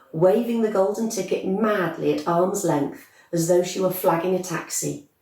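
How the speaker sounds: usual speaking voice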